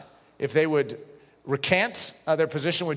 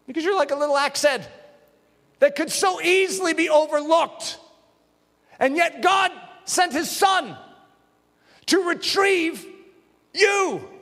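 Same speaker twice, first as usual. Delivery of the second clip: fervent preaching; 9 semitones higher than usual. Real, not acted. Preacher telling each listener God sent his son for them, fervent. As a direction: lift them up with it.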